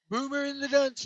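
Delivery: half-hearted mock Trump naming the pair; Trump impression. Host mocking a rival podcaster, lightly voiced Trump. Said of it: "I can't do a Trump impression".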